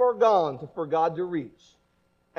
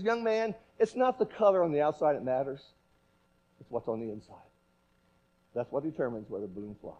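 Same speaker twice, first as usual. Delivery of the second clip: lower, softer patient correction; frustrated balloon man; 9 semitones lower, 6 dB quieter than usual. Retold or imitated. Balloon man lectures the boy, low and quiet. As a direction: deliver the lesson low and quiet. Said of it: "the blue man got real frustrated".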